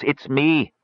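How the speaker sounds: put-on rough, weary old voice; hoarse old man. A narrator's reading. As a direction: croak it weakly. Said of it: "a hoarse voice croaked".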